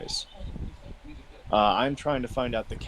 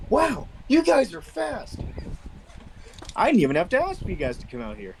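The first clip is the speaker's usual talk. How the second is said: amazed in character; 12 semitones higher than usual. Acted. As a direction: act out being impressed by their speed. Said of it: "and he'll go".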